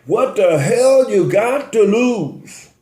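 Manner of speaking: hammed-up imitation, stretching out the challenge; Donald Trump. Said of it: "you have Donald Trump saying".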